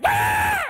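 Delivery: shrieking bird cry, very high and loud; piercing peacock screech imitation; peacock scream. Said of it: "these birds scream like".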